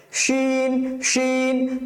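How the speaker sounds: শিন held long with a full-mouthed "sh"; thick shin. Shown as a demonstration. Teacher repeats the thick letter, full শিন. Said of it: "মোটা করে".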